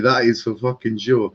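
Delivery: usual speaking voice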